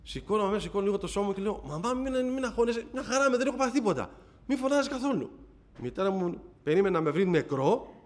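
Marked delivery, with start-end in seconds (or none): 1.7-5.3 put-on high-pitched, hurried child's plea; retold little boy hastily calming his mum; child reassuring mother. (παιδική φωνή που καθησυχάζει τη μαμά, ψηλά)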